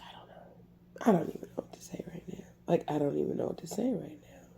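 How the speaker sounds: fast, voiceless whisper. Real, not acted. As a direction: murmur it to yourself, lost for words. Overwhelmed, whispering to herself.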